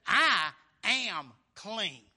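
loud, slow, drawn-out proclamation; bold declaration. Acted. Paul to the opposers, loud and slow. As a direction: plant each word like a verdict. Paul's final words.

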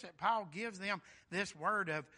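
ordinary delivery

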